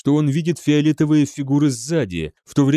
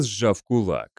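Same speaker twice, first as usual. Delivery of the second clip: ordinary delivery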